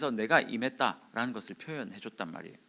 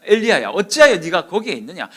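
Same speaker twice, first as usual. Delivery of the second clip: loud, higher, challenging call; forceful rebuke; 5 semitones higher, 13 dB louder than usual. Retold or imitated. Preacher as God confronting Elijah, loudly rebuking. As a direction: demand an answer at full force. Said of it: "엘리야에게 강하게 말씀하셨어요".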